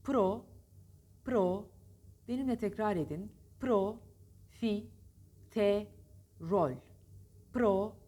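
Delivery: pro, fi, te, rol split apart; syllable by syllable. Shown as a demonstration. Teacher drilling the class syllable by syllable. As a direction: break profiterol into its syllables. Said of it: "hecelerini ayırın".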